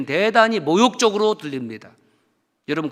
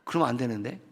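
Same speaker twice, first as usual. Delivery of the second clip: ordinary talk, nothing marked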